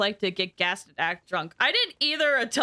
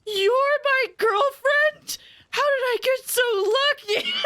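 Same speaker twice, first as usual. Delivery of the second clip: high, slow, wide-eyed wonder; amazed slow gush; 12 semitones higher, 4 dB louder, slower than usual. Retold or imitated. Waking patient to partner, high slow amazed gush. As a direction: stretch it out in delighted disbelief. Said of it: "And they're like".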